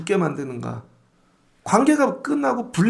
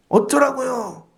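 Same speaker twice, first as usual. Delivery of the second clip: put-on defiant kid; 6 semitones higher, slower than usual. Kid talking back to the pastor before a crowd, defiant. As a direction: throw it back like a mouthy kid. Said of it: "순리를 모르는 애들".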